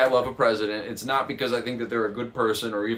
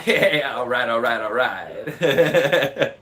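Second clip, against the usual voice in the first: Matthew McConaughey drawl; 6 dB louder, much slower than usual. Retold or imitated. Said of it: "President Matthew McConaughey".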